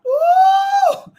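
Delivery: high shouted woo; ecstatic whoop. Real, not acted. Preacher whooping to the congregation, loud high woo. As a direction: let out a joyful preacher's whoop.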